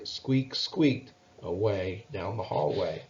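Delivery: usual speaking voice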